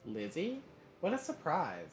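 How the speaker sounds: high, cheery greeting; pleasantly surprised, pitch lifted; cheery surprise. A narrator's reading. Dad surprised to hear Lizzie on the phone, cheery. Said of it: "He sounded cheery as usual".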